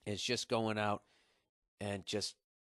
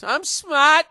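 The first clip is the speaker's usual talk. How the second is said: loud, high boast; hammed-up indignant boast; mock proud; 13 dB louder than usual. Real, not acted. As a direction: insist you're smart. Defending himself jokingly.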